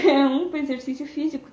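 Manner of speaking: laughing. Real, not acted. Laughs at own point.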